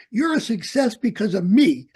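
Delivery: mock-boastful, through laughter; laughing. Old instructor teasing former student, laughing. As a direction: brag with a laugh in the voice. Retold phone call, laughing.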